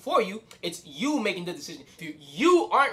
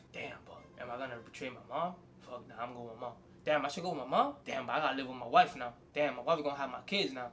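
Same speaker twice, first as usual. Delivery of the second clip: low, soft, torn self-talk; muttered inner debate; 8 semitones lower, 9 dB quieter than usual. Retold or imitated. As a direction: mutter your dilemma to yourself. Torn son's inner monologue.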